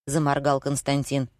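ordinary delivery

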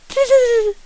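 a lamenting tss fading into the night; plaintive high tss. A narrator's reading. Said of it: "lamentable, plus aigu".

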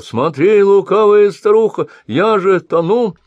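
high, loud, desperate shout; drowning cry. A narrator's reading. Drowning sorcerer screams at the sly old woman, loud panic. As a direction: yell for help as the water rises. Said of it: "закричал".

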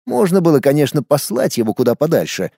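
usual speaking voice

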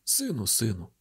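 low, soft, weary address; sorrowful appeal. A narrator's reading. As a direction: sigh the words out low to a son. Father sorrowfully addresses his son.

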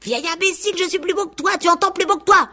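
very high, strained rooster screech; furious cry. A narrator's reading. Furious rooster leaps at the goose, crying out insults. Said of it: "cria de toute sa voix".

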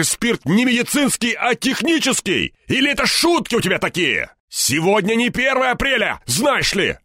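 high, exasperated shouting; irate high-pitched phone tirade; yelling into phone. A narrator's reading. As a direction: scold in loud, sarcastic disbelief. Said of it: "орал в трубку".

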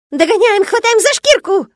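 very high, loud squealing critter; excited squeal. A narrator's reading. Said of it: "азартно верещал у меня в голове Шишок". (азартный визг зверька)

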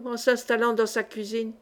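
ordinary delivery, nothing marked

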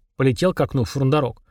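ordinary delivery

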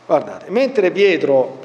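ordinary talk, nothing marked

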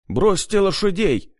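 high, urgent shouted order. A narrator's reading. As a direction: yell the command over the chaos. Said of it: "закричал Аким".

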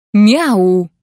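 a sharp, bossy meow; loud cat meow. A narrator's reading. The prince-cat loudly commands the mercenary with a meow. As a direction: meow like a cat giving orders.

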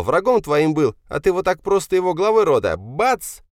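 high, loud accusing rant; hysterical tirade. Acted. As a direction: let the hurt son's voice run very high and loud. Hysterical son accuses his father. (истеричная тирада высоким голосом)

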